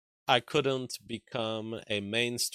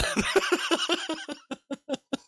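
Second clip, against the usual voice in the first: high burst of laughter. Real, not acted. Laughing at viewer's comment.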